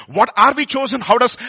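usual speaking voice

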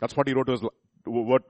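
ordinary talk

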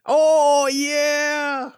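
squeaky high Oh yeah; high-pitched sing-song reaction; high mock excitement.

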